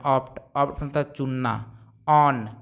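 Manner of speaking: normal talking voice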